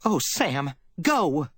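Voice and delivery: a secretary's breaking plea; wailing plea. A narrator's reading. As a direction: beg him to go, near tears. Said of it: "broke, and was thin and wailing".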